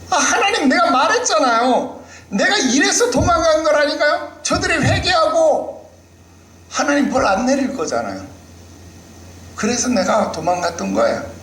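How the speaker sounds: high, heated complaint with a laugh; Jonah angry at God. Acted. Jonah complaining to God, high exasperated protest. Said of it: "그것도 막 불같이 화를 내요".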